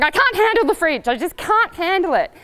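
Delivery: mock-panicked horse; anxious horse. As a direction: voice the horse losing its nerve over the fridge. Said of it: "So they're going".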